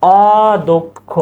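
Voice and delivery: slow teacher demo; slow pronunciation demo. Shown as a demonstration. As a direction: draw out অদক্ষ clearly. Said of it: "এটা কি?".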